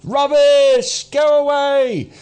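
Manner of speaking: high, loud, drawn-out jeer; heckling shout. Acted. People in the audience drive off the ranter, heckling shout. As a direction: shout the speaker off the stage.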